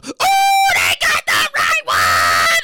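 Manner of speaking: loud, drawn-out catchphrase yell; slow booming "they got the right one"; hype shout. Acted. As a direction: belt the catchphrase.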